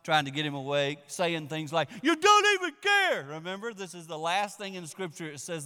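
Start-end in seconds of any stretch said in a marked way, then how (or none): 2.0-3.2 high, loud accusing shriek; screaming at him. Retold or imitated. Disciples screaming at Jesus, high and loud. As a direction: mock-scream the complaint at Jesus. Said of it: "screaming at him".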